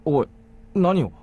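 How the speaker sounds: startled alarm. Acted. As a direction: blurt it out as she tries to stand. Shion suddenly tries to stand.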